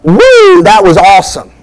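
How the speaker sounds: high, loud whoop of delight; loud excited woo; exclamatory shout. Shown as a demonstration. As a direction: sell it like the best news ever. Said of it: "the exclamatory is".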